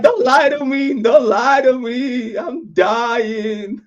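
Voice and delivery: high, drawn-out mock wail; melodramatic pleading. Patient begging the doctor for the truth, hammed-up wailing. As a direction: plead slowly, hamming up the despair.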